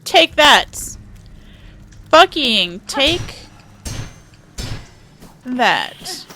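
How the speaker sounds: triumphant shout. Real, not acted. Gamer taunting an enemy in combat.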